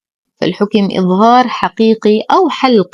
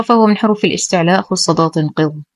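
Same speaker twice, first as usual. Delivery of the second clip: ordinary talk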